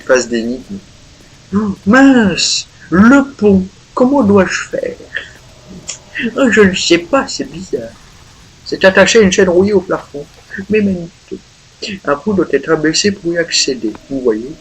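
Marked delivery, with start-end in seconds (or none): 1.5-5.0 high, loud put-on character voice; high character voice, worried about the bridge; voiced game character. 6.4-14.5 higher mock character voice. Voicing character.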